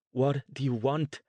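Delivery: demanding answers from the blonde woman. Acted. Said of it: "Pia demanded again".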